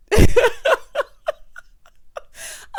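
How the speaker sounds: sudden loud, high wheezing cackle; real belly laugh, breath running out; burst of laughter. Real, not acted. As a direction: crack up loudly mid-story, helplessly. Cracks up at the recap.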